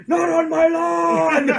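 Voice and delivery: high, slow, laughing yell; shouting at trespasser. Acted. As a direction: bellow at kids on the lawn. Old man yelling at trespasser.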